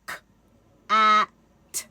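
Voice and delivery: K, a, t each sound said separately; phonemes sounded apart. Shown as a demonstration. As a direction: isolate every phoneme in cat. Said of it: "break that sound apart".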